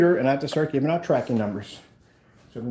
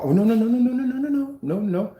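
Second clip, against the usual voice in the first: rising run of quick alarmed nos; 7 semitones higher than usual. Real, not acted. Vlogger reacting to a problem with rising alarmed nos. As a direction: panic as something slips. Something going wrong on camera.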